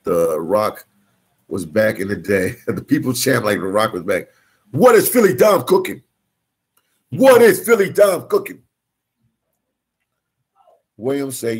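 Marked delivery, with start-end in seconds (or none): 4.7-8.6 high, loud mock announcer; hype voice. Host hyping his own cooking in a loud high voice. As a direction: shout it like a hype man. Hypes himself, high put-on voice.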